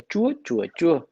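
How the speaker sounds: "chùa, chùa, chùa" on three different tones; Vietnamese tone contrast. Shown as a demonstration. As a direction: let each "chùa" be heard as its own tone. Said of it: "need to hear the words".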